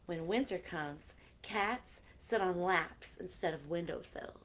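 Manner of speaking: gentle storybook reading with soft whispered lines; reading to children. Acted. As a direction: read it like a bedtime story to kids. Reading a picture book aloud.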